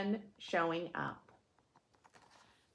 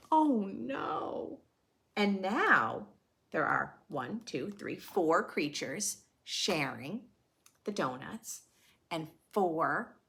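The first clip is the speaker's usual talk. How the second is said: put-on lively read-aloud for kids; children's story narration; storybook reading; 3 semitones higher, 4 dB louder than usual. A narrator's reading. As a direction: read the rhyme to a class. Reading picture book aloud.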